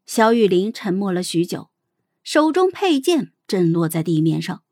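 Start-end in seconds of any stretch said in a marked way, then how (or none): none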